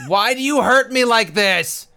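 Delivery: high, loud wail with laughter in it; mock anguished wail. Protesting to a friend over an earworm, mock anguish. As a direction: cry out as if wounded, hammed-up.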